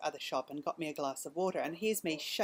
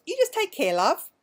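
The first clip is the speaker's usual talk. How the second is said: much higher, louder lilting goodbye; sing-song lady; 11 semitones higher, 12 dB louder than usual. Retold or imitated. Shop lady sending her off, high and sing-song. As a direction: chirp it like a kindly shopkeeper.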